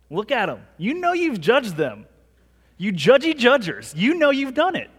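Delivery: teasing the congregation with a pointed finger; playful accusing. Acted. Pastor teasing the congregation about judging, mock accusing.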